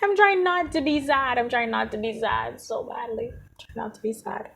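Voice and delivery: fighting back real tears as the words give out; close to tears. Real, not acted. Telling viewers she is trying not to be sad, on the verge of crying. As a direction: voice breaking high, then fading to almost nothing. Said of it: "I'm feeling really sad right now".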